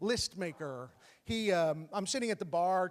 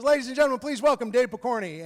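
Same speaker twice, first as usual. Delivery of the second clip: loud, raised emcee voice; club host announcement; 4 semitones higher, 8 dB louder, faster than usual. Retold or imitated. Host welcomes the new comic on stage, loud announcer voice. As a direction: announce the next act to the crowd. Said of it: "host goes on stage".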